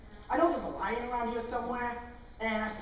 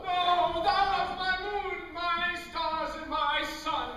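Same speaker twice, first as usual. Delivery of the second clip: slow, lightly higher sing-song; lovestruck verse; 6 semitones higher, slower than usual. Acted. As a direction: croon it to your beloved.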